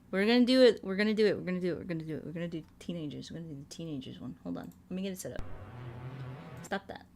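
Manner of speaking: ordinary talk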